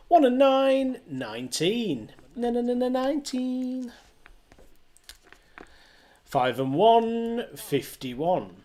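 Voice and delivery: sing-song rising then falling number calls; bingo caller. Acted. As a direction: call each number like a bingo hall. Online bingo number calling.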